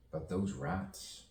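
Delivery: ordinary talk, nothing marked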